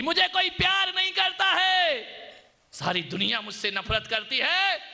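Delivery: put-on child yelling out. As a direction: cry it out into the forest. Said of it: "जोर से आवाज लगाता है".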